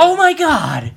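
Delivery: shocked. Acted. Shocked at the job offer.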